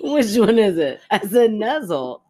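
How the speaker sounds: high-pitched, laughing protest. Real, not acted. Co-host denying she said a silly word, laughing. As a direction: argue it while cracking up. Giggling over a slip of tongue.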